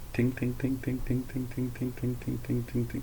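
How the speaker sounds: high, soft, steady ting-ting-ting; quick even metallic dings, kept light; imitated alarm bell. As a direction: mimic a distant crossing bell on one note. Imitating the rail crossing alarm bell.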